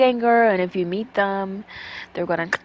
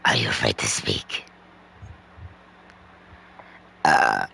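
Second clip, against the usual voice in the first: demon voice; 4 dB quieter than usual. Pretend. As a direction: greet the little boy like a demon. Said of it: "my demon voice".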